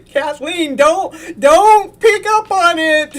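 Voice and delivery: loud, high, laughing plea; laughing as she begs Kathleen to drop it; laughing protest. Real, not acted. Host laughing at Kathleen's comment, loud laughing plea.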